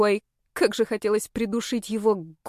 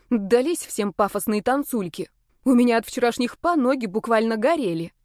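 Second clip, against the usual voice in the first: ordinary delivery, nothing marked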